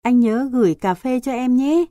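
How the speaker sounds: a little brother's voice calling from a distance; boy calling from afar. A narrator's reading. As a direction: call out to him as he leaves. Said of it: "Tiếng thằng Hiếu như vọng lại".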